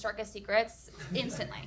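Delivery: usual speaking voice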